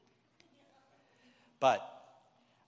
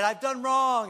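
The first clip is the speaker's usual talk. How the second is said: louder, higher cry of guilt; anguished confession; 9 semitones higher, 7 dB louder than usual. Retold or imitated. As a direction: blurt it out in despair. Judas confesses to the leaders.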